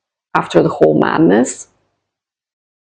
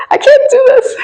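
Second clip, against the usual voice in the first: suddenly much higher and louder, strained and on the edge; overwhelmed squeak; 5 dB louder than usual. Retold or imitated. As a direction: squeak it out as your nerve breaks. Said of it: "I was like".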